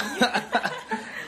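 high unvoiced burst of laughter at a student's slip. Real, not acted. Host cracks up at the student's mistake, laughing.

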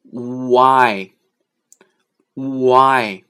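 "Why" drawn out as oo-eye with no h; slow pronunciation demo. Shown as a demonstration. Teacher modelling oo plus eye after explaining silent h, slow loud demonstration. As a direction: glide slowly from a long oo to eye in "Why". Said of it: "我们只要发U和I的音".

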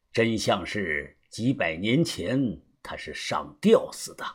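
low, soft, measured character voice; subdued older mentor, dropping away at the end; knowing elder, grave. Acted. Voices Uncle Xu revealing history.

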